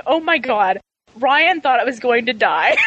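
normal talking voice

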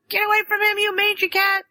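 high shrill shout at cat; yelling. Retold or imitated. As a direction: yell it up high. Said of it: "she's yelling at Socks".

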